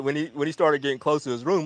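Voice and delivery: normal talking voice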